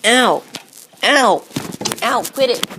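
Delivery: usual speaking voice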